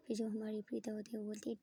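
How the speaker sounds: ordinary delivery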